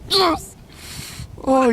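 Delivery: high cheer of victory; excited shout. Real, not acted. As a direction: cheer it out, thrilled. Deer goes down, hunter cheers.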